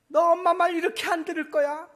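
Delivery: loud, high scolding shout; acted-out motherly outburst at full volume; angry mother yelling. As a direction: yell at a disobedient child as an exasperated mom. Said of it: "막 소리를 질렀습니다".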